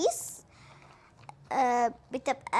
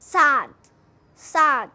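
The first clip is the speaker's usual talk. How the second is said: loud drill; 10 dB louder than usual. Shown as a demonstration. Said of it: "teacher drilling word for kids".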